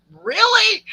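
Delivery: high, loud astonished really; surprised really. Retold or imitated. Retelling how she reacted to the cows saying, astonished. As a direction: act blown away by the news. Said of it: "And I'm like".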